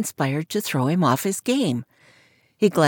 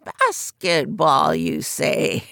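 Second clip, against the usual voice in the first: croaking fortune teller; much slower than usual. Acted. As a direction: croak it from behind a curtain. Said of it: "She chuckled softly".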